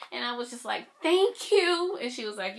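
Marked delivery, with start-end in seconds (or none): 1.0-2.0 high, loud acted-out gratitude; thrilled thanks. Fan thanking the lady for the band, thrilled. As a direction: squeal your thanks in the retelling.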